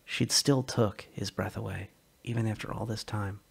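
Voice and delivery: usual speaking voice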